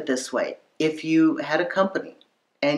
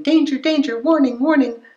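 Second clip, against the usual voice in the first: high, loud alarm call; warning signal; 12 semitones higher, 5 dB louder than usual. Acted. Chemistry signaling trouble to a dater, high alarm. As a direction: sound the alarm, pitched way up. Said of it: "is normally signaling".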